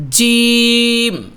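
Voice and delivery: জিম said firm and drawn out slowly; firm jim. Shown as a demonstration. Teacher drilling a firm, slow জিম for students. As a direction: hold জিম long and hard. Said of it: "জিমটা শক্ত করে হবে".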